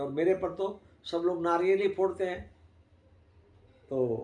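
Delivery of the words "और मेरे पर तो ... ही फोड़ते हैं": higher, put-on voice of a grumbling stone; a voiced stone sulking about its lot; complaining stone. Outer stone complains to idol. (ऊँची, बदली आवाज़ में शिकायत करता पत्थर)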